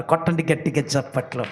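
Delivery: usual speaking voice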